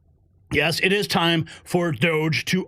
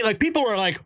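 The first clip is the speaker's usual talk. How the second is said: ordinary talk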